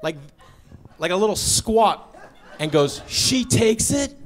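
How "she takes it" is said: slow clerk demo. Retold or imitated. Clerk demonstrates in a squat.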